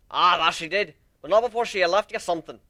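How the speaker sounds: a hint of a gruff minotaur; lightly voiced Steelhorn. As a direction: boom it out quickly.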